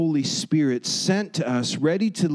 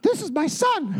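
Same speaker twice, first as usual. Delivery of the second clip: high, squeaky cartoon voice; 13 semitones higher than usual. Preacher mocks how God doesn't sound, squeaky chipmunk voice. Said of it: "like Alvin and the Chipmunks".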